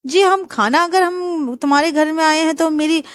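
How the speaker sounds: nosy aunt questioning the groom; bossy aunt. Acted. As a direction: grill the boy like a nosy aunt.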